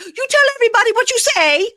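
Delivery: very high, fast mock scream; loud retold shriek; hysterical yelling. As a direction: scream it like an outraged wife. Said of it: "hysterically yelling at Josh".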